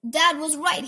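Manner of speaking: pirate gloat, har har; smug, hammed-up pirate laugh. A narrator's reading. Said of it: "she gloated".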